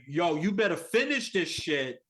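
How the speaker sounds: loud, threatening New York tough talk; New York accent. Retold or imitated. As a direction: yell it like a furious street fighter. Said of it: "in that real New York accent".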